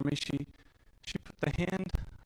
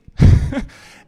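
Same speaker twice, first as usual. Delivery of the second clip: laugh; 19 dB louder than usual. Real, not acted. Self-mocking joke during a sad story.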